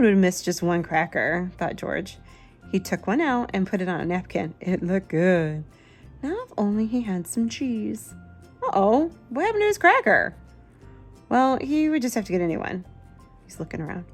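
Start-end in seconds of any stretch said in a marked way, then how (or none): none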